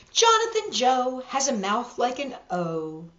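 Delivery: plain reading voice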